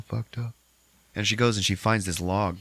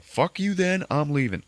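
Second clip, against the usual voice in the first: higher, curt put-on storm-off; mock huff; 8 semitones higher than usual. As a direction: snap it and leave. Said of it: "Lane's just like".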